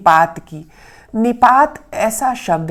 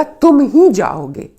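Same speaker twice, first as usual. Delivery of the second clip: "ही" slowed and louder; stress on ही; 3 semitones higher, 4 dB louder, slower than usual. Shown as a demonstration. Teacher stressing "ही" to show emphasis. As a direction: insist on "ही": nobody else goes. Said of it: "जोर डालने के लिए".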